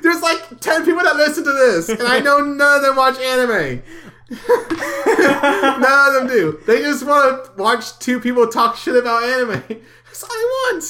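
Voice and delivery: high, loud laughing rant; excited rant. Real, not acted.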